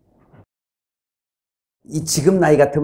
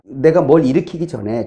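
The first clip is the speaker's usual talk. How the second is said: ordinary talk, nothing marked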